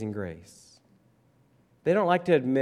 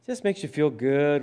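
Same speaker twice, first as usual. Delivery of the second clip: mock gushing. Preacher mimics what everybody says of Jesus' teaching, mock gushing. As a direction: stretch it out in mock admiration. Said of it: "Everybody says that Jesus' teaching was just".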